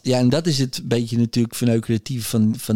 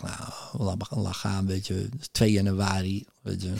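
hushed indifference; 4 semitones lower, 8 dB quieter than usual. Retold or imitated. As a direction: mutter it low and dismissive, barely voiced. Dismissing wife's hospital worry.

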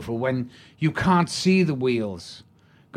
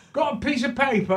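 hammed-up Cockney pub heckler, pitched way up; Cockney fan; 13 semitones higher, faster than usual. Cockney stranger barging into the pub snug, high hammed-up Cockney. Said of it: "He goes".

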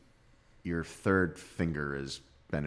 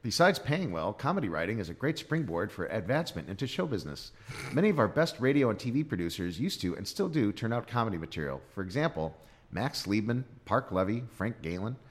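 a touch higher, announcer-style read; mock pamphlet read; 4 semitones higher than usual. Shown as a demonstration. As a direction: read it like a fifties promo. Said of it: "Stop! You had me at".

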